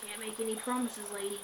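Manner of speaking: lightly voiced sinister caller's veiled threat; creepy caller. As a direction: let it chill the listener. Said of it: "sent shivers down my spine".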